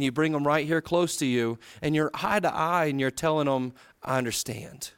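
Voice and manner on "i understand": sincere. Shown as a demonstration. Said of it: "you want 'em to see the sincerity".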